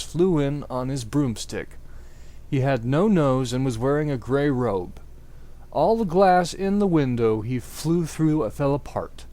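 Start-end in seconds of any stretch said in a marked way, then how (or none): none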